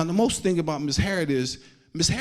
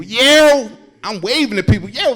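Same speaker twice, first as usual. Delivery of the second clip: high, loud happy yo; waving at strangers after the wave, excited; excited shout; 8 semitones higher, 8 dB louder than usual. Acted. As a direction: call out excitedly while waving.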